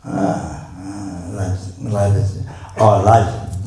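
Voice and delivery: normal talking voice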